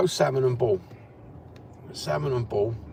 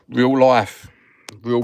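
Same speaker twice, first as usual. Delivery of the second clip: loud declaration; 11 dB louder, much slower than usual. Real, not acted. Proclaiming to viewers.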